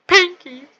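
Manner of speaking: pinky squeaked high and loud; pinky jumps up to a loud squeal; squeaky pinky. Shown as a demonstration. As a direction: shriek pinky in a silly high voice. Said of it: "Pinky, pinky".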